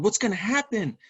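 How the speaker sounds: high-pitched, acted-out concern; mock worry. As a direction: fret aloud like an anxious viewer. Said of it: "You're, like, concerned".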